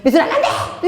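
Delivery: high-pitched acted scolding; hammed-up parental ban; mock forbidding mother.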